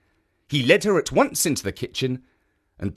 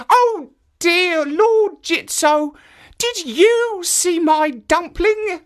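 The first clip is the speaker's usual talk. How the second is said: put-on old woman voice; 5 dB louder, slower than usual. Old woman asks statue.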